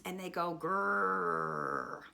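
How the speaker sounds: slow low growling grrr; a hammed-up bear growl for kids. Teacher imitates bear growl.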